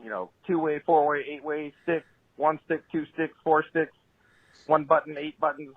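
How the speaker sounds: ordinary delivery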